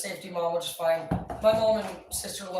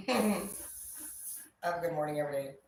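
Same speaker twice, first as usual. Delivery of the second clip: grudging Nick; 3 semitones lower, 4 dB quieter than usual. Retold or imitated. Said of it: "He was like".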